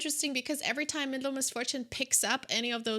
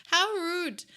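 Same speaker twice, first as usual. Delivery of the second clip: mock indignant; 7 semitones higher, 3 dB louder than usual.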